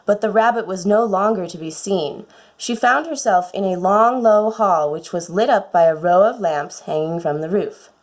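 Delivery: ordinary delivery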